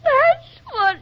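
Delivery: high, loud words broken by sobs; crying too hard to finish; sobbing. Acted. Wife sobbing to her friend about her husband, high and broken.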